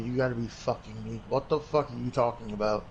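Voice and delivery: normal talking voice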